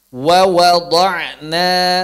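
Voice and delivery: very slow, drawn out Wa wada'na; slow recitation. Shown as a demonstration. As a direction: recite Wa wada'na slowly with rounded waw. (Wa wada'na dibaca sangat pelan)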